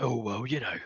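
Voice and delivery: nervous about telling the boss; a touch of worry, dropping to a whisper; worried goblin. Acted. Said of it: "he gets a bit worried and goes like".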